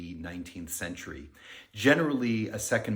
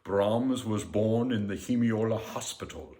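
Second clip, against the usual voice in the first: light imitation of a thick German accent; a hint of an old German teacher's accent; mild mock German accent; Otto Werner Müller's German accent. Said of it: "in a very thick German accent".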